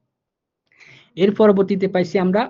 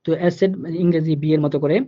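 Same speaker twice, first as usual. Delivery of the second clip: normal talking voice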